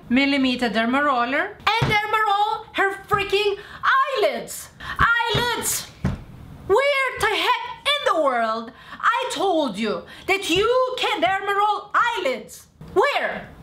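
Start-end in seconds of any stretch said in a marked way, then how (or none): 1.6-5.9 incredulous, almost shrieking at the idea; exasperated outburst. Real, not acted. Venting to viewers about a client's eyelids, exasperated. 6.7-13.5 raised high voice demanding where; indignant, high-pitched questioning; exasperated scolding. Real, not acted. Scolding followers who misused her product, indignant.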